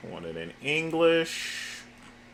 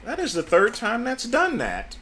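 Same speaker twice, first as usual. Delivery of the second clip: exasperated complaint; 12 semitones higher, 5 dB louder than usual. Real, not acted. Chatbot repeats the same error.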